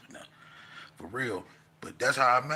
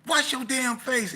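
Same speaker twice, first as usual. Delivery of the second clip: telling him off; 6 dB louder than usual. Retold or imitated. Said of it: "and we like".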